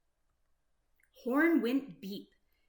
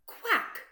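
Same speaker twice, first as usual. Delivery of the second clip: high, louder mock quack; duck quack; 11 semitones higher, 4 dB louder than usual. Duck's call in story.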